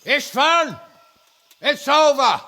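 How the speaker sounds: loud call to the castle walls; shouting up. Acted. Lord shouting up to the besieged castle, loud. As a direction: bellow it up at the enemy on the walls.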